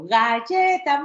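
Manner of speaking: slow, high praising "galleta"; praising a toddler. Shown as a demonstration. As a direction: cheer the child on gently. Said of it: "Ah, perfecto".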